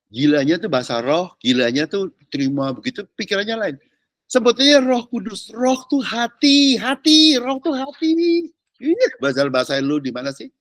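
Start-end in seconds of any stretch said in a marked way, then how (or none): 5.6-8.4 slow, rising, punched "roh tuh hati"; emphatic repetition. Acted. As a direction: drive the words "roh tuh hati" home slowly. Preacher hammers home point. ("roh tuh hati" ditekankan pelan dan makin tinggi)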